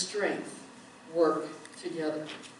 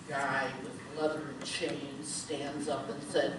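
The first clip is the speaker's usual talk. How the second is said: ordinary delivery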